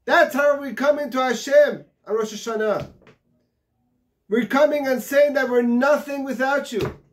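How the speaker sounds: high, loud fervent sermon; fiery preaching. Acted. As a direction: preach it out to the whole congregation. Preaching at full force.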